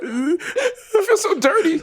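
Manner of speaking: high, breaking, laughing mock sob; mock sobbing. Glove after the deed, mock sobbing. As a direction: wail it like a ruined cartoon. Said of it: "he just cried, like".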